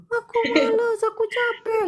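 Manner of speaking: high, sobbing whine; imitated crying. Psychic imitates how the client usually cries, high and sobbing. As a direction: wail it like someone worn out from crying. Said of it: "Biasanya kamu teriak".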